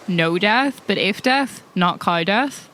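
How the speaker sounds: ordinary talk, nothing marked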